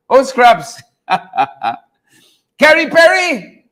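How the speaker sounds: ordinary talk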